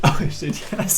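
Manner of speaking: ordinary talk, nothing marked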